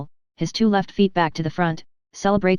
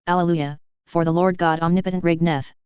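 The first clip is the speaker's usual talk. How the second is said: ordinary delivery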